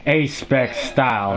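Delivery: low, slow catchphrase read; slow, low-pitched line demo; demonstrated catchphrase. Shown as a demonstration. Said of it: "I want you to run into those ants and say".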